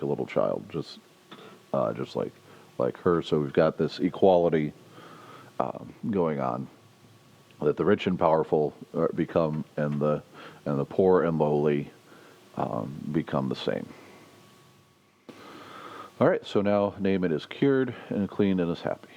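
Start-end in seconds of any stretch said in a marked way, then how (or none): none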